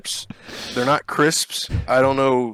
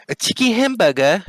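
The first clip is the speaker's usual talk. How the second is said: high-pitched British questioning lilt; mock English accent; 8 semitones higher than usual. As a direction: mimic a British accent, higher. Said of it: "they call it a".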